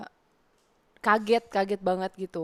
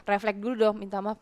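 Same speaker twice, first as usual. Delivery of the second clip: usual speaking voice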